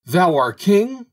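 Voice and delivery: high, loud, drawn-out outraged question; angrier disbelief. A narrator's reading. Birds challenging the bird in the hole, loud angry disbelief. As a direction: cry it out slowly in rising outrage. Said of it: "cried the birds still more angrily".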